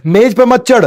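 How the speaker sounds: loud high scolding; shouted no. Shown as a demonstration. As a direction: yell it at the child. Shouted vs calm contrast.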